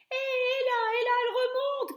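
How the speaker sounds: high, loud squealing alarm; panicked shout. A narrator's reading. Cook feeling the mouse climb his thigh, high loud shriek. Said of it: "cria l'homme".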